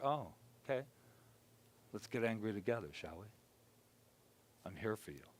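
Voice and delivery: soft, hushed talk with himself; gentle inner dialogue. Shown as a demonstration. Speaker demonstrating self-talk with his hurt self, quiet and tender. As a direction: coax the truth out kindly.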